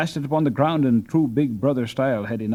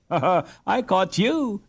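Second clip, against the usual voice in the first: put-on higher girlish laugh thrown back; a sister laughing her retort back at her brother; 8 semitones higher than usual. A narrator's reading. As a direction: turn the boast back on him. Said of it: "she retorted".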